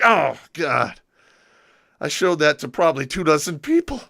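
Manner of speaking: mock horror at the embarrassment; mortified groan. Friend realizing he showed the card around, loud mortified dismay.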